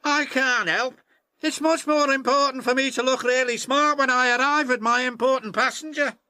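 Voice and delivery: high, fast, self-important engine voice; a show-off brushing off his friends; vain James. Acted. James refusing to help his friends, high vain voice.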